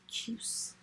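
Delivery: soft whisper of the spelling word; hushed dictation whisper. Shown as a demonstration.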